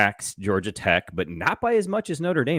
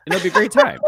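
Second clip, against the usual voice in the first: loud, high, laughing line; joking laugh; 8 semitones higher, 7 dB louder than usual. Real, not acted. Laughing at own joke.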